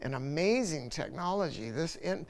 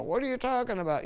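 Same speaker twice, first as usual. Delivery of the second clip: higher and fast, mock disbelief; incredulous reaction; 9 semitones higher, faster than usual. Annoyed listeners reacting to the fifty thousand dollar bargain, mock disbelief. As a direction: play the annoyed customer who can't believe it. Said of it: "have them go".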